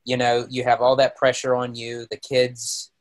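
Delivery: normal talking voice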